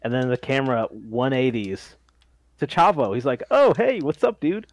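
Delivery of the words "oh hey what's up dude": high, loud, breezy hello; mock casual greeting. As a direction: act out an over-casual greeting. Voicing a wrestler's casual greeting.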